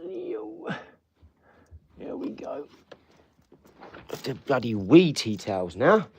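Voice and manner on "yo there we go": very high but soft coo; high soft pet talk. Acted. Owner settling the puppy, very high soft coo. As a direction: gently coo to the dog.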